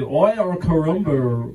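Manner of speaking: slow, jokey fake Australian accent. Comic to the crowd, a slow mock Australian accent. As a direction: toss off a bad Australian accent. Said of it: "in Australian accent".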